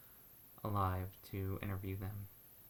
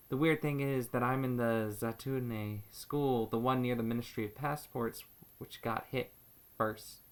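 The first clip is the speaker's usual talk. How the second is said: earnest testimony, building louder and more emphatic; dramatic monologue reading; 6 dB louder than usual. Acted. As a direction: read it as a heartfelt stage monologue, leaning on key words. Performs a teenager's written monologue.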